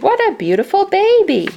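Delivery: high, fast, delighted gush; proud father bird gushing; thrilled. A narrator's reading. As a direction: gush over the new baby.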